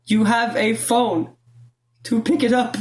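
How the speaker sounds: high, slow, drawn-out complaint; mock exasperation. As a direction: spell it out pointedly to someone absent. Complains at absent boyfriend who won't answer.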